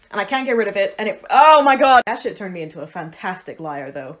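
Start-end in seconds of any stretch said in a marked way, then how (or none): 1.3-2.0 sudden outburst. Real, not acted.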